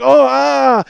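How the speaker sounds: loud, high cry of hurt; imitated pained yelp; acted pain groan. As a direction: groan like rising on injured ribs. Injured father rising in pain.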